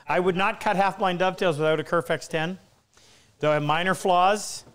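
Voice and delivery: ordinary talk, nothing marked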